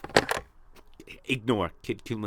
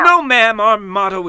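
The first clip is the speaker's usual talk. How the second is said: much higher and louder heroic cry; heroic outburst; 7 semitones higher, 12 dB louder than usual. Acted. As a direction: burst out proudly, refusing the money. Hero eagerly refusing payment.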